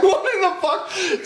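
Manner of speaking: slow, very high laughing disbelief; incredulous laughing. Retold or imitated. Teen baffled by the punch, laughing incredulously. Repeats shocked reaction to punch.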